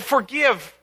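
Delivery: understated forgive, recalled; mild retold plea. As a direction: echo the plea lightly. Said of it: "Amos said".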